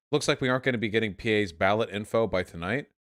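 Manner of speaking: ordinary talk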